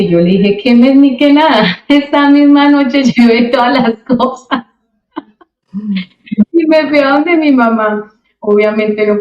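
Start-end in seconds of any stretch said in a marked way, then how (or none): 0.6-1.6 high, loud scoff at the offer; scoffing retort. Retold or imitated. Scoffing reply to mother. 1.8-8.1 laughing. Real, not acted. Laughs while recalling.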